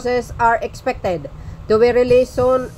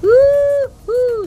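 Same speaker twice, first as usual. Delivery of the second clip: loud, very high "Woo-hoo!"; mock cheer; 5 dB louder than usual. Real, not acted. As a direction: whoop in gleeful mock triumph. Cheering Remulla's inclusion. (malakas at matinis na hiyaw ng tuwa)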